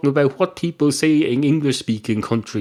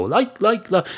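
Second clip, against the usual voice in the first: higher-pitched mock youth filler; mimicked youngsters; 8 semitones higher than usual. Imitating young people who cannot say anything, higher mocking chant. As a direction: parrot the youngsters' verbal tic. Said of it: "They go".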